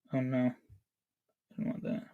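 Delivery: breathy unvoiced whisper, slow. Real, not acted. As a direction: whisper it under your breath, dreading it. Dreading being known by viewer.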